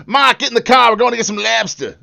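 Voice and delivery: loud, high, hammed-up Massachusetts accent; broad Massachusetts accent, dropping the R's. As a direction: holler it like a cartoon Massachusetts local. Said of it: "you're probably talking Massachusetts".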